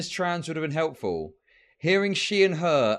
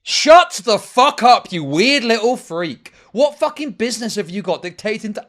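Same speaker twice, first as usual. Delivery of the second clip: angry rant; 3 semitones higher, 7 dB louder than usual. Real, not acted. Streamer rants at clip.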